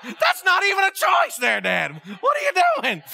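cracking up while calling him out; laughing disbelief. Retold or imitated. Son laughing at his father's coconut cream pick, laughing shout.

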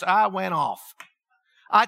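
ordinary delivery

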